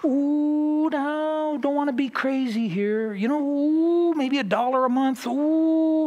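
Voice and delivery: high, drawn-out whiny devil, slow; high, slow fretting tempter cooing "ooh". Retold or imitated. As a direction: coo like a worried devil talking you out of giving. Devil cautioning against giving.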